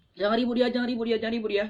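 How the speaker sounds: loud, insistent "jangan ribut dia" over and over; teacher nagging noisy students, loud and insistent; repeated loud hushing. Shown as a demonstration. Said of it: "nggak perlu sampaikan seperti itu".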